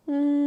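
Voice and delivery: steady bee-like hum; humming. Shown as a demonstration. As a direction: hum with lips closed. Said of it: "explore different sounds of humming".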